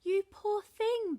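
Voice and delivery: loud, high, slow booming welcome; big booming giantess voice. A narrator's reading. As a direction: boom it out like a kindly giantess. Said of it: "boomed the giantess".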